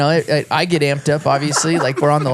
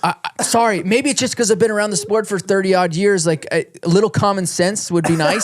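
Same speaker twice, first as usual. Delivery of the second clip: mock defensive self; 7 semitones higher than usual. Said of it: "And I'm like".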